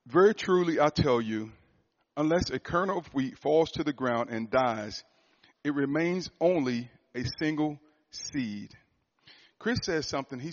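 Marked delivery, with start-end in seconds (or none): 0.1-8.7 raised, louder scripture read. Acted. Preacher reading John to the congregation, proclaiming. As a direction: proclaim the verse to the church.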